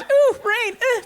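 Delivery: high-pitched imitation of a dainty woman; mock dismayed squeal. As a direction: squeal briefly as if rain hit you. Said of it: "For a second she does that".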